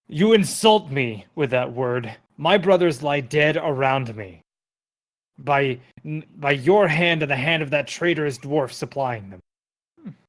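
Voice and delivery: loud, furious giant roaring his grief; pinned giant shouts at the party in frustration; angry shout. Acted. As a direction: bellow it out, insulted and grieving. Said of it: "he shouts in frustration".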